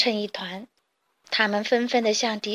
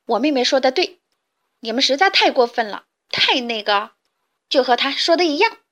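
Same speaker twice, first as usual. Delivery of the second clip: loud, indignant backing-up; angry shout; 2 semitones higher, 5 dB louder than usual. Acted. Camelido angrily backs his sister against the chicks, loud. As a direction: scold them hotly. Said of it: "卡梅利多生气地喊着".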